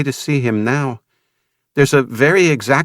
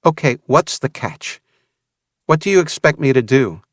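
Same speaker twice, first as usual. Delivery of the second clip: disbelieving sneer; 8 semitones higher than usual. A narrator's reading. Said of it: "A disbelieving sneer curled her full lips".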